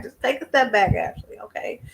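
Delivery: hushed self-scolding, almost voiceless; a breathy pep talk to herself; whispered self-talk. Real, not acted. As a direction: whisper it to yourself, calming down. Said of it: "I need to take a step back".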